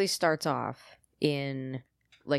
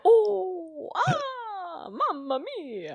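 hammed-up Italian accent, much higher and slow; mock Italian accent; 4 dB louder, much slower than usual. Mocking a TV chef's teeth with an Italian accent. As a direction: play a cartoon Italian crying mamma mia, pitched way up. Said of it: "Do they chatter with an accent?".

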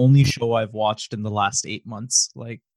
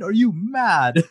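slow, higher drawn-out "Are you mad?"; mocking UK rapper drawl; mock UK taunt; 8 semitones higher, slower than usual. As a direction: stretch each word like a teasing London rapper. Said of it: "That's my favorite".

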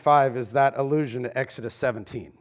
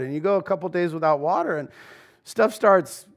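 usual speaking voice

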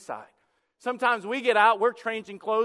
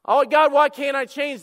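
loud, higher mock wail to God; anguished shout; 6 semitones higher, 11 dB louder than usual. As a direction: cry out in hammed-up despair. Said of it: "And God says dialogue".

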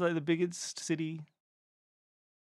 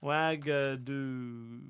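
Ouagadougou slow and softer, syllable by syllable; drawn-out syllables; 3 semitones lower, 7 dB quieter, much slower than usual. Real, not acted. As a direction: stretch out each syllable of Ouagadougou. Repeats the answer syllable by syllable.

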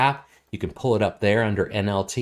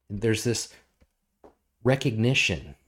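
ordinary talk, nothing marked

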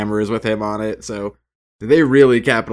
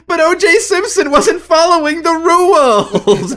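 much higher, louder, slow laughing whine; whiny reporter girl; 9 dB louder than usual. Pretend. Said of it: "her going".

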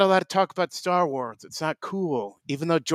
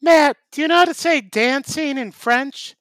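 high, louder character voice; goofy Tim voice; 6 semitones higher, 5 dB louder than usual. Acted. Tim quizzing Matt about French, high goofy voice. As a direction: stay in the silly persona.

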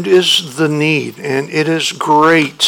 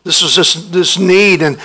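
ordinary talk

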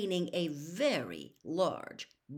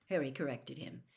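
ordinary delivery, nothing marked

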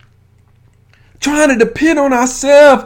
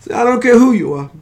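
normal talking voice

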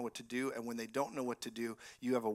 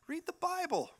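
mocking imitation; 7 semitones higher than usual. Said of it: "when people are like".

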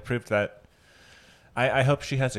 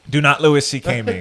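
loud; 5 semitones higher, 8 dB louder than usual. Real, not acted. Mock protest, laughing.

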